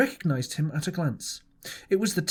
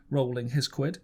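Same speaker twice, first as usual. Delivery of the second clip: plain reading voice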